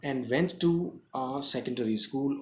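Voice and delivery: very slow, deliberate reading; slow dictation. Shown as a demonstration. Teacher reading the text slowly to the class. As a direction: read it slowly, word by word.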